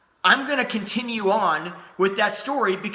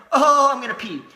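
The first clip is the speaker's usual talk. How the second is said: mock dramatic; 5 semitones higher, 6 dB louder than usual. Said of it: "It's like".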